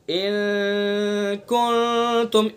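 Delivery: इन कुंतुम slow and long, the n hidden in the nose; nasal ikhfa. Shown as a demonstration. Teacher showing the right nasal reading, slow recitation. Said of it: "इन ऐसे नहीं पढ़ेंगे".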